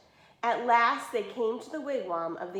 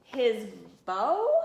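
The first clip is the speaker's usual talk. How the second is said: startled, louder question on bow; stammered surprise; 6 dB louder than usual. Acted. Sisters caught off guard by the question, stammering. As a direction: caught out, blurting it back. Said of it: "they stammered in surprise".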